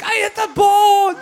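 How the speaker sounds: high, loud, drawn-out cry of disbelief; ecstatic shout. Retold or imitated. Son on first base shouting in stunned joy, high and loud. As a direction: yell like you can't believe you did it.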